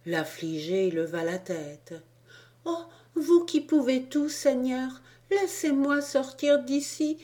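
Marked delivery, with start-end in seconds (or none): 2.6-7.2 put-on high, tearful little boy begging the Lord; a child on his knees crying out to be let go; much higher, louder desperate child's plea; pleading child's cry. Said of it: "s'écria-t-il en se jetant à genoux".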